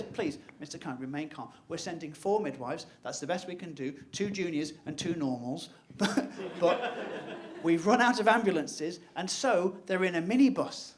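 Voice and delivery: low, soft, unhurried midwife voice; soothing an anxious caller on the phone; calm midwife. Retold or imitated. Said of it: "She says".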